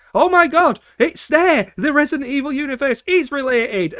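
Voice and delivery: squealing discovery; excited gasp. Retold or imitated. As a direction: blurt it like a thrilled fan. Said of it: "I'm like".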